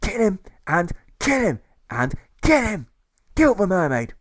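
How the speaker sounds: high, loud and slow chant; ranting officer, high and loud; frenzied shouts. Acted. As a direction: drag out the demands, shouting. Officers bay for execution.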